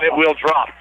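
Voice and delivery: ordinary talk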